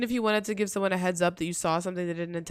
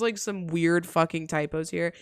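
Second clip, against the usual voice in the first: normal talking voice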